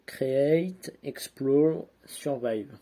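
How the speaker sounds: slow, loud mock English accent on the game slogan; French speaker hamming up an English accent. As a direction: announce it like a trailer in an English accent. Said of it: "j'ai un accent anglais de malade".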